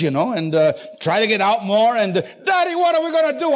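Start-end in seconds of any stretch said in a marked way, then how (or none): 2.1-3.5 put-on high, nagging child's cry; retold frantic kid, pitched way up; hollering daughter. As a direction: imitate the daughter hollering for Daddy. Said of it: "she was hollering".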